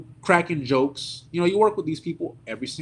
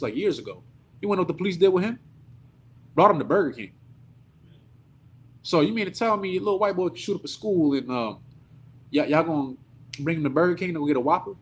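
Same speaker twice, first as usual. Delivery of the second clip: ordinary delivery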